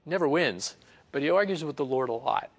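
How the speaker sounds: ordinary talk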